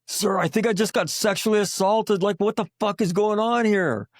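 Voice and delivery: high-pitched mock yell of a startled young Marine; imitating a yelling Marine. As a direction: squawk it out like an outraged grunt. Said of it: "Marines just yelling".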